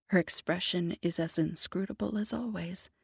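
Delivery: usual speaking voice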